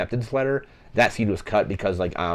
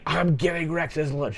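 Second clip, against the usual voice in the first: retold yell; 8 semitones higher than usual. Recapping the movie's lunch argument, mock yelling. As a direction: imitate a yell at conversational volume. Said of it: "Just, like, yells".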